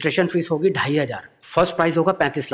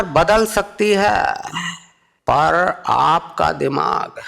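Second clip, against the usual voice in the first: very slow, higher, pausing orator voice; Modi farewell speech; 4 semitones higher, much slower than usual. Retold or imitated. Modi's slow grand farewell to the comedian. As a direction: build it like a grand speech line. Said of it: "और बोले".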